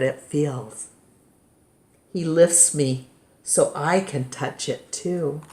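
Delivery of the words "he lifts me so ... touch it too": usual speaking voice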